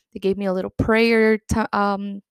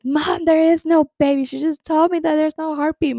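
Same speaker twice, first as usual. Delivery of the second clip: high, loud protest at mom; retold yell; 7 semitones higher, 4 dB louder than usual. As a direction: re-enact yelling desperately at your mom. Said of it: "I yelled at my mom".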